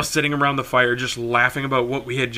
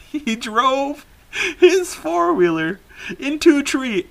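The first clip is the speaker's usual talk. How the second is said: high-pitched, cracking up while talking; laughing; 12 semitones higher, slower than usual. Real, not acted. Telling friend how uncle crashed, helplessly amused. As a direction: squeeze the words out through real laughter.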